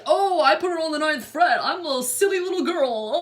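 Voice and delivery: mock silly girl. Mocking how men make her feel, in a ditzy parody. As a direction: play the silly little girl they think you are.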